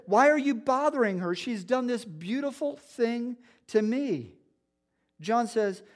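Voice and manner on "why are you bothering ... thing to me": higher, louder rebuke; preacher voicing Jesus rising to her defense; Jesus rebuking. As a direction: call them out sharply as Jesus. Preacher voices Jesus defending Mary.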